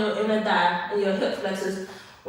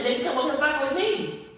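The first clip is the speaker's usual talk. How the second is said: ordinary delivery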